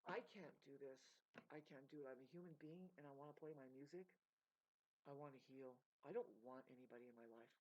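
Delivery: low, subdued confession; weary, overwhelmed. Real, not acted. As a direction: say it worn down, pitched low. Overwhelmed plea to be left alone.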